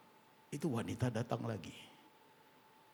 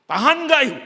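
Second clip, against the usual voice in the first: shouted challenge; 21 dB louder than usual. Acted. Preacher shouts question.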